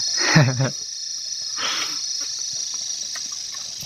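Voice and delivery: soft, breathy chuckle trailing off; laughing. Real, not acted. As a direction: laugh quietly, mostly on breath. Laughing among friends in chat. (nui, thaw nen)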